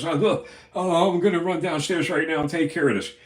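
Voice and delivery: flustered promise to fix it; mock surprised hospital guy; shocked. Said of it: "the guy was shocked".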